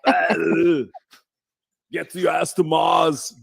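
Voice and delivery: slow, mock Austrian movie-hero shout; hammed-up Austrian bark, drawn out; Arnold Schwarzenegger impression. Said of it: "can you do an Arnold Schwarzenegger".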